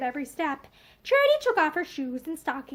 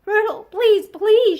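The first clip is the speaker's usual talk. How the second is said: higher, louder pleading please; begging; 5 semitones higher, 8 dB louder, slower than usual. Acted. Charity pleading with her weeping cousin, higher and louder. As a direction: beg her to stop crying. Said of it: "she begged".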